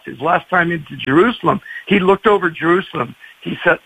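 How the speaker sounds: ordinary talk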